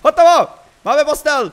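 high, loud shout of triumph; excited triumph. Real, not acted. Player announcing the finished bed to viewers, excited. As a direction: shout it out, thrilled to have finished.

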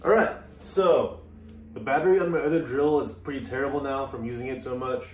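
normal talking voice

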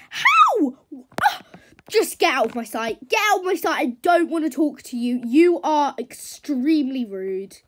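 furious dismissal. Acted. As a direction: order them away in a huff. Horse orders the others away.

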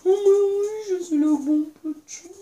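put-on high crying baby voice. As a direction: whimper like a big baby. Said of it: "il croit qu'il est encore bébé". (voix de bébé pleurnichard)

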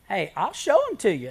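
loud, laughing offer; laughing joke. Real, not acted. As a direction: laugh it out playfully. Preacher jokes about his feet.

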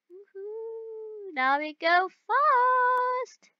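high, drawn-out thrill-ride cheer; whoop, then stretch the last word high; gleeful whooping. Real, not acted. Driver as the car speeds up, gleeful whooping.

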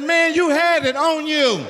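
high, slow accusing; mock scolding. Dad busting boy with weed on him, high slow scolding. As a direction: drag out the accusation.

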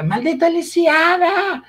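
high, drawn-out melodramatic shriek; scandalized soap-opera villainess cursing a rival; telenovela outrage. Retold or imitated. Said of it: "Quando Soraya chega no quarto".

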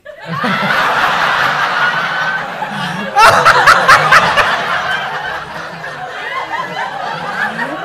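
loud high shrieking laugh. Real, not acted. Crowd member says she's on sick.

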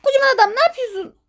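high-pitched exasperated protest; indignant scolding. Real, not acted. Player scolding the monster that ate him, high and indignant. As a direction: scold the culprit in disbelief.

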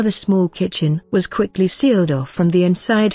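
ordinary delivery

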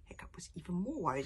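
ordinary delivery, nothing marked

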